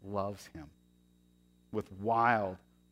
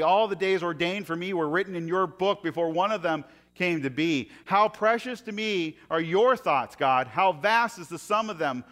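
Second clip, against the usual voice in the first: louder, higher psalm reading; reading scripture; 5 semitones higher, 6 dB louder than usual. Acted. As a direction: read the psalm with rising fervour. Reads Psalm 139 aloud.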